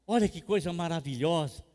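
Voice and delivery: usual speaking voice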